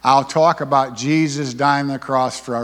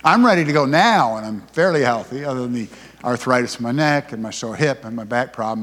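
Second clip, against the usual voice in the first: ordinary delivery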